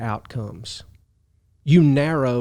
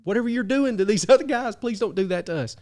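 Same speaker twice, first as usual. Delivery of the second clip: begging; 9 semitones higher than usual. Retold or imitated. Captain begging the prophet.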